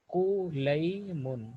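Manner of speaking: قلیمن stretched very slowly, each syllable held; slow drawn-out repeat. Shown as a demonstration. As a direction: spell out قلیمن syllable by syllable for the students. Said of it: "قلیمن، repeated for the class". (قلیمن کو بہت آہستہ کھینچ کر بولیں)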